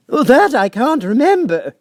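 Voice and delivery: high, loud, slow quavering plea; trembling Hatter. A narrator's reading. Hatter pleads with the King, trembling high and slow. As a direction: beg for mercy, voice shaking.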